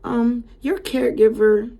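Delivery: very high-pitched innocent phone voice; mock-innocent client on the phone; imitated voice change. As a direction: put on a high, dainty complaining voice. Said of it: "whole voice change".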